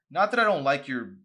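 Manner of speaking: loud flustered blurt. A narrator's reading. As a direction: blurt it out nervously. Arson flustered outburst.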